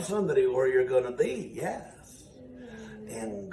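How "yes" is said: ordinary delivery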